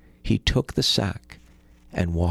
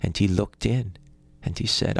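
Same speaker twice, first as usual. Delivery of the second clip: ordinary delivery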